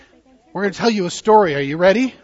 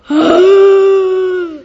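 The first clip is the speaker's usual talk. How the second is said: big, high, loud mock gasp of alarm; dramatic gasp; 10 semitones higher, 11 dB louder than usual. Storyteller acting out the storm for the children, loud gasp. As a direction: gasp like the storm just hit.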